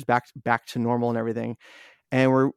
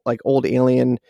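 normal talking voice

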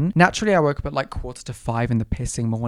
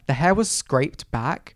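ordinary talk, nothing marked

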